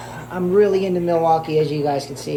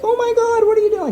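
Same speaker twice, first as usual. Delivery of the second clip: much higher, louder mock-horrified viewer; shocked saltwater fans; 6 dB louder than usual. Saltwater viewers gasp at the host, high mock horror. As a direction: squeal it like an appalled hobbyist. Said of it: "They're like".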